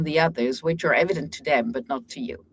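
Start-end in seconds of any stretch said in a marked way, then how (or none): none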